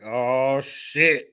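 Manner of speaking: a hint of dread under the breath; muttered oh shit. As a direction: Hades mildly dismayed. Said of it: "Hades standing there shaking their head like".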